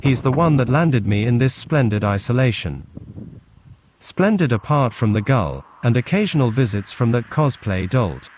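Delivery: usual speaking voice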